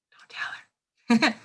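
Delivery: slow conspiratorial whisper; playful whisper. Acted. Teacher to students about borrowing daughter's pen, playful whisper. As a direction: let the class in on a secret, whispered.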